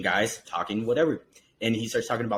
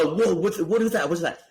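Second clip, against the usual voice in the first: startled; 4 semitones higher than usual. Retold or imitated. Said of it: "he's like".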